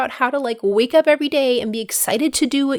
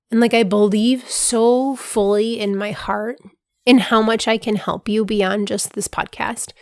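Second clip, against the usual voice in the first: usual speaking voice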